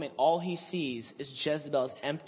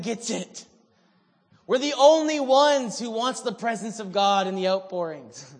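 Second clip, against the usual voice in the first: loud, high self-pitying whine; whiny complaint; 7 semitones higher, 13 dB louder than usual. Retold or imitated. Elijah complaining to God, loud high self-pitying whine. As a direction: whine about being the only one. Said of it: "so he goes".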